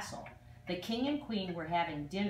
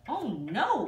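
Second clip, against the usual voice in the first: a sharp, louder cry of alarm; cried out; 5 semitones higher, 5 dB louder than usual. Acted. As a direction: gasp it out in dismay. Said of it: "cried the lords and ladies".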